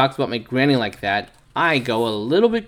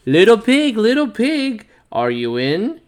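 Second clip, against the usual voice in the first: calling out; 10 semitones higher, 5 dB louder than usual. Acted. Wolf calling at the door.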